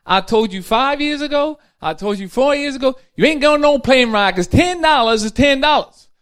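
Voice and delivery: high, loud, nagging put-on voice; wife scolding her husband again about the ten dollars. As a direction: scold him like an old wife who's said it before. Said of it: "She said".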